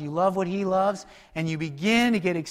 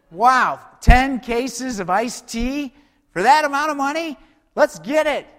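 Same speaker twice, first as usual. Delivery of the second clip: higher and louder, slowed gasp of delight; mock bargain excitement; 5 semitones higher, 5 dB louder than usual. Shopper spotting cheap iced tea, gushing higher and louder. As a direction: gush over the iced tea deal like a shopper.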